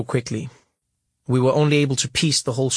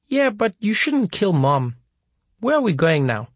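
put-on child's voice; 7 semitones higher than usual. A narrator's reading. Four-year-old talking to his father in the car. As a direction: ask it like a small boy.

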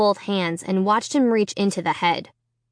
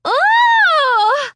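high shouted cry of disgust; a loud, sick yelp; sick moan; 7 dB louder than usual. A narrator's reading. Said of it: "I uttered a sick moan".

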